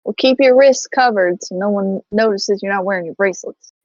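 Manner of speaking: ordinary talk, nothing marked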